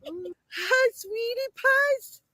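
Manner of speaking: high, slow coo. Real, not acted. Cooing at donkeys.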